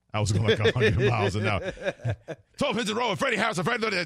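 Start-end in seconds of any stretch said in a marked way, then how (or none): 2.6-4.0 rapid-fire radio DJ; fast DJ patter. Retold or imitated. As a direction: rattle it off like a speed-talking DJ. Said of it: "I sounded like I was on speed".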